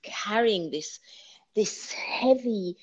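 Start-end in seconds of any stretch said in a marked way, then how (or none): 1.6-2.8 breathy whisper on heavy. Real, not acted. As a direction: drop to a slow whisper. Heavy burden described.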